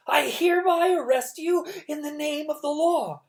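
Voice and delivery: Mister Poe arresting Olaf, loud and harsh; harsh announcement. A narrator's reading. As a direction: declare it harshly. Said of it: "announced in a harsh voice".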